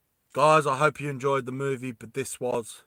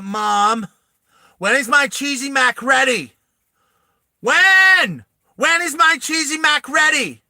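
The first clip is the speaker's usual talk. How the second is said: put-on high, loud whining kid voice; whiny kid; 9 semitones higher, 8 dB louder, slower than usual. Spoiled kid whining at his mom about dinner, high and loud. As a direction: nag for the cheesy mac like a spoiled brat. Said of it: "He's like".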